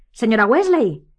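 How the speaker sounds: Harry's hoarse cry; hoarse shout. A narrator's reading. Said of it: "gritó Harry con voz ronca".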